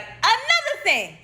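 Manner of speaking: high, drawn-out proclamation; emphatic announcement. Retold or imitated. Patient adding a prophecy to her nurse, high emphatic announcement.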